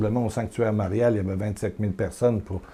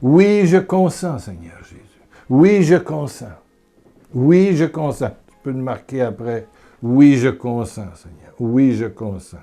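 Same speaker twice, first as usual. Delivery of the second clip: fervent prayer; 2 semitones higher, 6 dB louder than usual. Acted. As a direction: pray it with yielding devotion. Prière répétée avec musique. (prière fervente)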